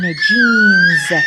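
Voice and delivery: جينز stretched out loud with an initial English "j"; example word. Shown as a demonstration. Teacher gives students an example, loud drawn-out pronunciation demo. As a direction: draw out جينز as "jeeenz". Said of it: "قادر يجي الحرف جي كيما".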